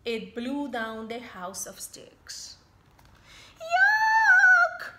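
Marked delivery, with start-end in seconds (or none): 3.7-4.9 very high, loud yuck; disgusted squeal. Acted. Pigs squealing at the snotty sneeze. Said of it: "squealed the little pigs".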